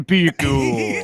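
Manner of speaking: slowed, garbled pecan; slow backwards pecan. Pretend. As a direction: play Pecan backwards and slow, all smeared. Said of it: "You play it backwards and in slow motion".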